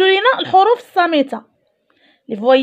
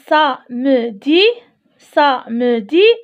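slow sounding out; much slower than usual. Shown as a demonstration. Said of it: "teacher sounding out slowly".

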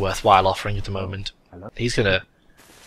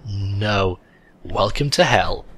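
normal talking voice

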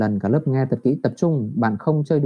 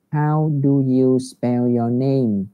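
slow, careful teacher model; slow model reading; much slower than usual. Shown as a demonstration. Teacher reads the question slowly for pupils to copy. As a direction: set each word down slowly. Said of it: "thầy dịch lại những câu đấy".

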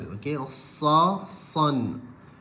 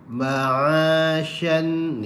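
usual speaking voice

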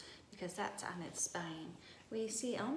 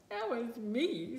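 slow, louder mock sob as a furry creature; tearful sob; 3 semitones higher, 5 dB louder, slower than usual. A narrator's reading. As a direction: sob it out slowly, hammed-up for a children's story. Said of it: "sobbed the creature".

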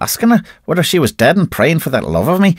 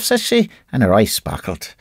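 plain reading voice

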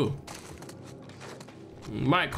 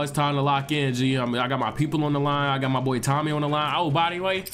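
ordinary talk

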